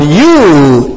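you lifted and pushed; emphatic you. Shown as a demonstration. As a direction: give the word you weight. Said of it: "is emphasized in the Greek".

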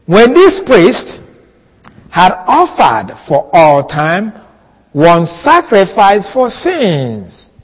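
reads the verse slowly, word by word; slow scripture reading. Shown as a demonstration. Preacher reads the verse to the class, slowly. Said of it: "uh, when it says".

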